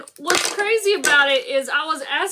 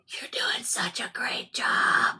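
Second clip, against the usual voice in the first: slow, hushed whisper of encouragement; whispering in ear; 5 dB quieter, slower than usual. Acted. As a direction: whisper it slowly, right into someone's ear. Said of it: "whispering in your ear".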